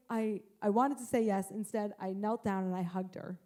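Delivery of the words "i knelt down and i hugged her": normal talking voice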